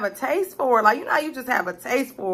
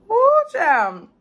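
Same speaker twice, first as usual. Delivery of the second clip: excited whoop; 10 semitones higher, 4 dB louder than usual. Real, not acted. Spicy food hits.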